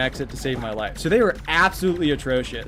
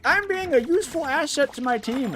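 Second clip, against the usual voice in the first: high mocking voice of a smug healer; 12 semitones higher than usual. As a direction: mimic the smug teammate, pitched high. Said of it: "they're just like".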